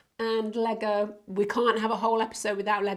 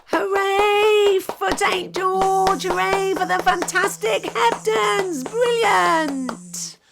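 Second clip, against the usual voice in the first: higher, much louder, slow rousing cheer; 8 semitones higher, 7 dB louder, slower than usual. Real, not acted. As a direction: cheer like leading a crowd. Host cheers the children's film.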